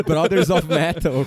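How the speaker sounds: put-on jolly Italian pizza chef accent; mock Italian metal singer, laughing. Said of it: "com sotaque italiano".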